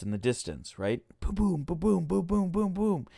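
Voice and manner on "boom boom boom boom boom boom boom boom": high, rhythmic mouthed booms; gunshot sound effects. Retold or imitated. Storyteller adding gunshots to liven up the story, imitated booms. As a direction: imitate a burst of distant gunfire. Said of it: "That's sound effects".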